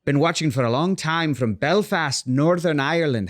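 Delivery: half-committed Northern Irish accent; bad Irish accent, breaking into a laugh at the end; terrible Irish accent. Shown as a demonstration. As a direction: read it out in a shaky-footed Irish accent. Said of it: "Sorry, that's terrible".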